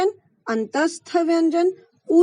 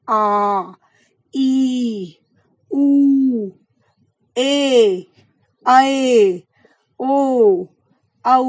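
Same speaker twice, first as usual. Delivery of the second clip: long drawn vowels; 5 dB louder, much slower than usual. Shown as a demonstration. Teacher to students showing long vowels, drawn out and loud. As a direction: stretch आ, ई, उ, ए, ऐ, ओ, औ long and louder. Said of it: "लग रहा है ना ज्यादा समय".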